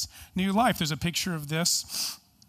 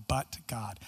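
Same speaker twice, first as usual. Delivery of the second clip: quiet quote; 7 semitones lower, 6 dB quieter than usual. Retold or imitated. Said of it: "pastoral colleague Link say".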